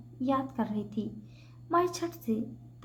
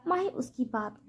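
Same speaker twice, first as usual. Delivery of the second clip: usual speaking voice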